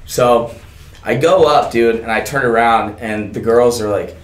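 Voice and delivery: ordinary delivery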